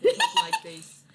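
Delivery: high, loud cartoon Aussie; laughing, over-the-top Aussie twang; mock Aussie accent. Shown as a demonstration. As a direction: squawk it in a hammed-up Australian accent. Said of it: "It's an Aussie accent".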